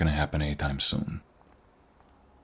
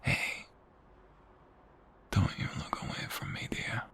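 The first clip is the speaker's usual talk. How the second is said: teasing whisper; 4 semitones higher, 5 dB quieter than usual. Acted. Teasing partner, holding her gaze.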